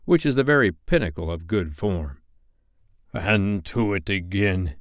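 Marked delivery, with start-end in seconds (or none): 3.1-4.7 despairing. A narrator's reading. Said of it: "he cried despairingly".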